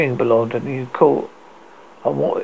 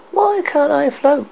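high confused; 13 semitones higher, slower than usual. A narrator's reading. Arya confused.

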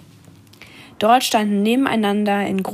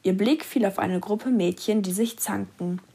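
ordinary delivery

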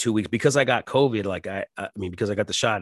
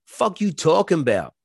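retold outburst; 8 semitones higher, 4 dB louder than usual. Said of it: "I kept".

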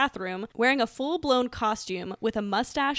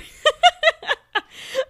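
high loud burst of laughter; 10 dB louder than usual. Real, not acted. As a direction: cackle at the memory. Laughing at the story.

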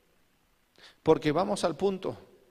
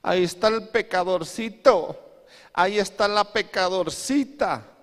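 high, sneering gossip about sinners; mock judgmental; 6 semitones higher, 4 dB louder than usual. Preacher mimicking churchgoers judging sinners, high and sneering. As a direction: point and sneer, a little louder. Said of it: "nosotros decimos".

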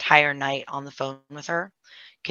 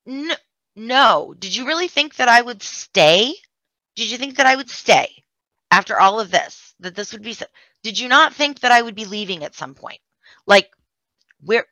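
raised, higher mock-furious challenge; furious retort; 6 semitones higher, 5 dB louder than usual. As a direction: hurl the questions back at her, indignant. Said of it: "I was very furious".

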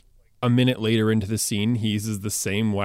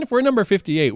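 ordinary talk, nothing marked

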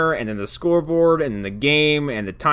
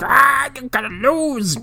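high, drawn-out mock villain taunt; growling; 12 semitones higher, slower than usual. Sheamus taunting players around the court, high mock growling. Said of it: "running and growling around this court".

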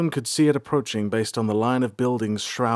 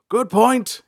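Bolthar admitting; 13 semitones higher, 8 dB louder than usual. A narrator's reading. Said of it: "he admitted".

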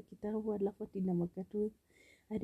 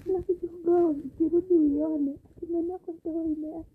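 excited; 8 semitones higher, 8 dB louder than usual. Real, not acted. Laughing retelling tooth ritual.

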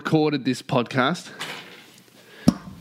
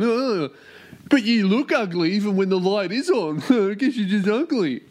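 higher mock-heckler voice; smug would-be comedian; 3 semitones higher than usual. Host imitating people who think they're hilarious geniuses, smug. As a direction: parody someone pleased with their own joke. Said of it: "people who think they're hilarious geniuses going".

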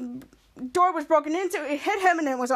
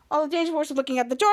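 usual speaking voice

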